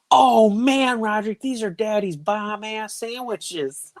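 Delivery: put-on high-pitched kid's announcement; imitated child. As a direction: play the daughter, drawn out and high. Retold child proudly announcing dinner.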